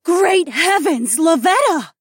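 very high, loud, drawn-out exclamation; awed cry. A narrator's reading. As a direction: cry out in amazement. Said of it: "Mila cried out".

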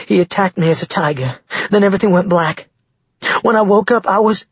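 high, distressed woman's voice; anxious, climbing in pitch; frightened. A narrator's reading. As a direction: tell it in mounting panic. Frightened woman tells of attack.

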